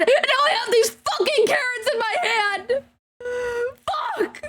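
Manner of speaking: character crying and raging at Doctor Pryor for betraying the Ministry of Health; tearful accusation. Shown as a demonstration. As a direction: sob out the accusation at the traitor. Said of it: "switch up voices really quickly".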